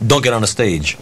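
ordinary talk, nothing marked

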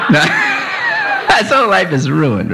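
laughing through the words, high and slow; words stretched out by laughter. Real, not acted.

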